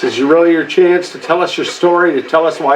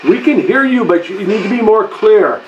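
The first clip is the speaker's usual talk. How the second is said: calling out; 7 semitones higher than usual. Real, not acted. Calling out to a spirit.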